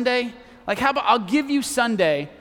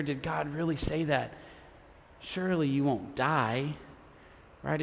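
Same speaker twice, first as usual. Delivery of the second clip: low, soft serpent's insinuation; hushed whispering; 6 semitones lower, 11 dB quieter than usual. Acted. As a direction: murmur it softly, like a secret in the ear. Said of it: "it whispered in their ear".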